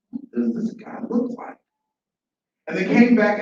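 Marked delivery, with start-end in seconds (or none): 0.1-1.6 hushed, secretive whisper; huddled whispering. Acted. Ants huddle to confer secretly.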